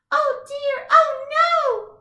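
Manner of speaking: high, loud panicked cry; mock-frightened robot; scared robot. Shown as a demonstration. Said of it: "The robot was so scared".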